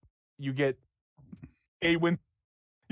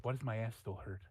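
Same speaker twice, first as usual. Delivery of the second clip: muttered thought; 5 semitones lower, 13 dB quieter than usual. Acted. GM voices character's stray thought.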